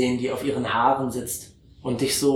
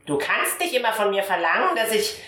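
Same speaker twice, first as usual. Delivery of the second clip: higher, put-on old auntie voice; Tantchens Stimme; 8 semitones higher than usual. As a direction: whine it up high like a fussy old aunt. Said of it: "und sagt so". (hohe, quengelige Tantenstimme)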